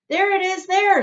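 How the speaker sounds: high, loud excited shout. Acted. A bystander shouts that he sees the ticket, high and loud. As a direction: point it out to everyone. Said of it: "someone else shouted".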